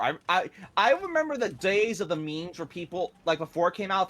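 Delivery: ordinary talk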